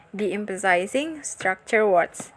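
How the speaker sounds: ordinary delivery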